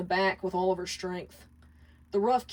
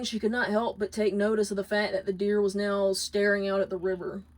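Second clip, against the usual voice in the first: usual speaking voice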